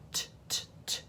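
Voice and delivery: soft voiceless "t" taps; isolated T sound. Shown as a demonstration. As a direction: tap out the bare "t" consonant quietly. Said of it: "starts with t".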